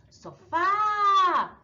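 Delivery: sofá with FÁ long, high and loud; stronger stressed syllable. Shown as a demonstration. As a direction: stretch and lift the last syllable of "sofá". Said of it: "o acento é a mais forte".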